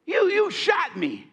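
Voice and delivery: a wounded man crying out in shock; high strained cry of a shot friend; shocked disbelief. Retold or imitated. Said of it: "he looks at me and goes".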